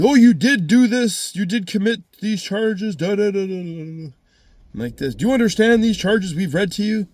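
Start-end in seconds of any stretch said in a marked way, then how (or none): 0.1-4.1 mock accuser. Court reads charges to defendant, mocking imitation. 5.1-7.1 high, louder mock questioning; imitated court official. Court asks if charges are understood, mock official.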